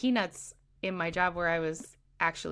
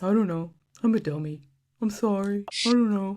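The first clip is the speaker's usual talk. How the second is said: a hint of a dopey, helpless boyfriend; mock dopey boyfriend; 5 dB louder than usual. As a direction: lightly play him as a clueless dummy. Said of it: "It's just".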